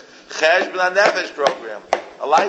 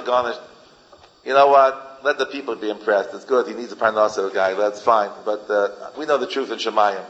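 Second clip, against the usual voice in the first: ordinary talk, nothing marked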